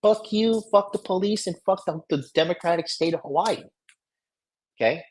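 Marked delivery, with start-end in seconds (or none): 0.0-3.6 high, angry yelling at the cop; cussing out a police officer, high angry yell; furious rant. Retold or imitated. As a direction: shout it down at the officer, raging.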